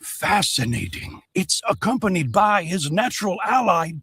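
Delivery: hushed wildlife-film narration; nature documentary. Acted. Narrator observing emu through binoculars.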